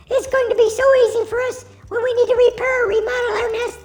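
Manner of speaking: normal talking voice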